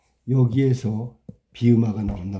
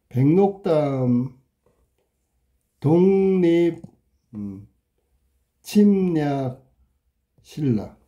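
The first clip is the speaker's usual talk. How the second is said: slow dictation; much slower than usual. Shown as a demonstration. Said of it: "바뀐 걸 찾아야".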